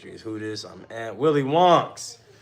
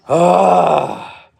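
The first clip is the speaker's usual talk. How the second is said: strained grunt; 5 semitones higher, 12 dB louder than usual. Real, not acted. Exertion during push-ups.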